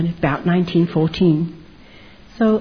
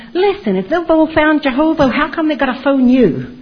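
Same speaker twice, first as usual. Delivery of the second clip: mocking husband; 9 semitones higher, 3 dB louder than usual. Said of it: "he'd sit and mock me".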